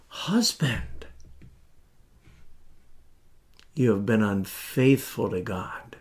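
compassionate sorrow. Shown as a demonstration. James pleading with dear friends, sad and compassionate. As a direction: grieve over them as you say it. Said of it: "compassionately, so sad".

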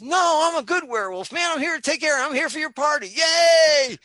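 high, loud, hammed-up friendly werewolf; party cheer building to a big yay; eager reassurance. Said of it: "and Fred goes".

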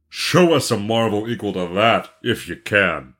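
character voice unlike the narrator's; scornful gang leader. Acted. Gang leader showing off the cap, scornful challenge. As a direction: play the challenging boss of the boys.

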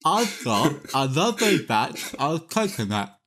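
slow, garbled, tongue pulled out; holding tongue with fingers. Real, not acted. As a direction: mangle it with your tongue held. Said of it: "grab your tongue and hold it out and say this word".

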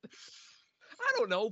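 slow, laugh-wracked I don't know. Real, not acted. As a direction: barely get it out through laughter. Laughing fit on the podcast.

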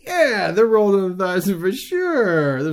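high, loud, overdone assurance; imitated shady seller. Cigar seller humoring a joking tourist, high hammed-up agreement. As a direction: oversell it with a drawn-out mock yes.

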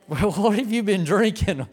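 laughing disbelief. Retold or imitated. Laughing at coworker's wild claim.

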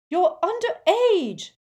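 high, loud, slow shout; furious mum yelling at her daughter; mother shouting. A narrator's reading. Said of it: "Missus Weasley shouted at her daughter".

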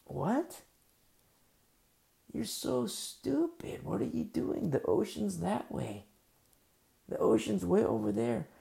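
high, slow sneering ridicule; scornful mockery. Passerby ridiculing Noah building a boat inland, high and scornful. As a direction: jeer at him like he's a fool. Crowd mocks Noah's boat.

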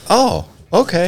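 slow, high, drawn-out oh okay; mock surprise. Narrator recalling his own baffled reaction, high and slow. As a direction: play it dumbfounded and a little slow.

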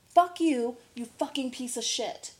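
sudden burst of irritation; annoyed cursing. Real, not acted. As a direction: swear at a pest. Cursing at a mosquito indoors.

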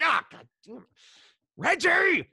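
mock outrage yelled at his mentor; mock exasperated yell. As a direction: throw up your hands and holler his name.

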